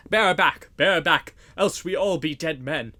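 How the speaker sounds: loud, urgent shouted command; loud retreat order. A narrator's reading. Sheriff orders his men to retreat, urgent loud shout. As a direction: yell the retreat in alarm. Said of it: "cried the sheriff in a loud voice".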